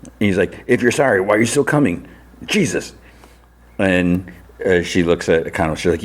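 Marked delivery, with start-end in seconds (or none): 0.7-2.9 pitched-up imitation of a fed-up son; mock exasperation. Son to mom who keeps coming, exasperated imitation. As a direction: retell an irritated complaint, higher all through.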